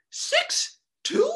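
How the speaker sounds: high mock disbelief; surprised. Real, not acted. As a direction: squeal it in disbelief. Incredulous repeat of height.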